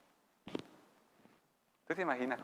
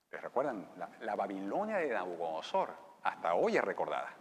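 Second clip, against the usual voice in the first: usual speaking voice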